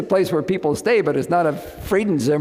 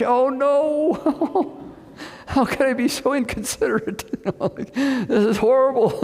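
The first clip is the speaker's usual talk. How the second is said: laughing at himself; 9 semitones higher than usual. Real, not acted. Realizing guests were left waiting.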